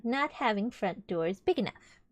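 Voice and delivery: plain reading voice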